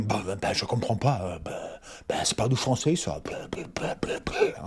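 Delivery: hushed, sputtering French snob. Acted. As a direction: sputter in low, dismissive disbelief like a French snob. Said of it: "that's my French snob voice".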